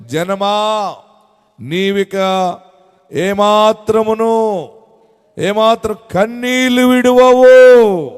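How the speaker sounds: slow, drawn-out scripture proclamation; preached verse. Acted.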